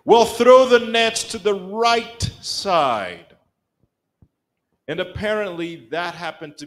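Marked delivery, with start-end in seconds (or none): 0.0-3.4 higher, louder and slow instruction; Jesus voiced. Preacher voicing Jesus telling disciples where to cast, slow and raised. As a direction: call out the instruction slowly with a knowing edge.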